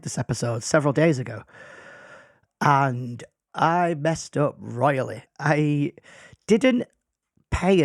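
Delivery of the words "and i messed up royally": normal talking voice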